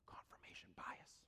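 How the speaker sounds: unvoiced aside to the room; whisper. Real, not acted. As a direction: breathe it as a secret. Aside naming the bias.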